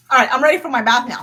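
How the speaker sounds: usual speaking voice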